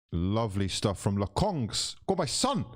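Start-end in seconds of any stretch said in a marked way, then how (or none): 2.1-2.7 high cheering call, urging him on; excited cheer. Real, not acted. Fan urging a player on during a run, excited cheer.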